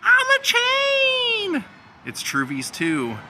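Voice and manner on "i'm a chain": very high, long drawn-out scream; imitated movie scream, sky-high and held; mock scream. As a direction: wail it out, stretching the last word. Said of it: "she screams out".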